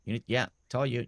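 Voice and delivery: usual speaking voice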